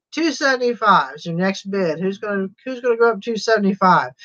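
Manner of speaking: rattled off quick like an auctioneer; auctioneer fast talk. Shown as a demonstration. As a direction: rush the call like an auctioneer. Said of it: "I can't talk real fast like an auctioneer".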